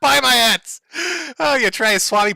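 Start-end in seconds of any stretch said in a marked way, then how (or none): 0.0-0.8 louder, punchy sales yell; hyped merch shout. Acted. Streamer yelling at chat to buy his hats.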